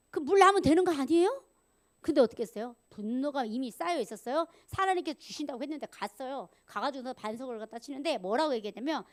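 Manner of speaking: ordinary talk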